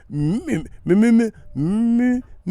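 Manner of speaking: high, loud stuttered "mmm" cranking; imitated ignition failing to catch; lawnmower-like engine sputter. Showing a car that won't kick over, sputtering engine noise. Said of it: "It sound like a lawnmower".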